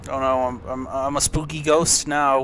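ordinary talk, nothing marked

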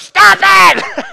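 wife in labor screaming at her husband to stop; furious shout. Retold or imitated. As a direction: yell it at him to stop counting. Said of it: "and she goes".

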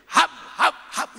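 loud, high bursts of mock mauling; imitated bear attack. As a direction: snap it out like bears biting. Bears mauling the boys.

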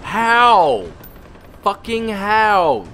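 outraged disbelief at the screen; exasperated cry. Real, not acted. Player frustrated at his missed shots, exasperated cry.

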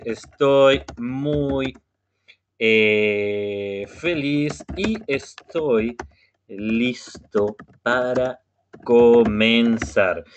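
very slow, drawn-out model sentence; slow demo phrase for the class; slow speech. Shown as a demonstration. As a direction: spell it out slowly for learners. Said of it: "voy a hablar un poquito más despacio".

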